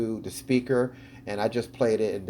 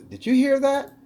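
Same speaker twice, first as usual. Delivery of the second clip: mock startled question; 13 semitones higher, 6 dB louder than usual. Quoting man on ghost video.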